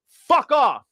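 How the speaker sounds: high, sharp fuck off demo; blunt brush-off. Shown as a demonstration. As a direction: shut him down in one go. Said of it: "telling people to fuck off".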